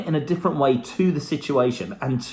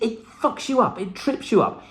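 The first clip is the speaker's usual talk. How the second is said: ordinary talk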